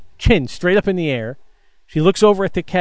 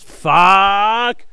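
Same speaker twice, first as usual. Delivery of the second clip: loud, high cry of frustration; yelled into the air; 6 semitones higher, 5 dB louder than usual. Retold or imitated. Worker sees another man with bags, yells in frustration. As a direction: throw the curse up at the ceiling, loudly. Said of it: "like that, into the air".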